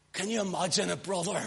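ordinary talk

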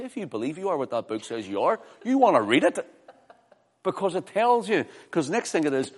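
rising to loud, high exhortation; emphatic preaching. Real, not acted. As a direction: press the challenge on the congregation.

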